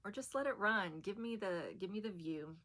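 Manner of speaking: ordinary delivery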